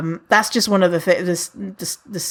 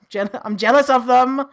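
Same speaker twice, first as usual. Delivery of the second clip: ordinary talk, nothing marked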